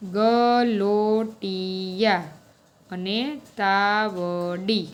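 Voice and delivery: very slow, each syllable stretched out. Shown as a demonstration. Teacher giving pupils words from the story, slow syllable-by-syllable delivery. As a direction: sound out the words slowly, syllable by syllable.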